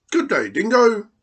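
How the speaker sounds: high, loud, snapping greeting; snappy platypus. Acted. Platypus greets Dingo at the bank, high snappy voice. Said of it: "snapping his bill".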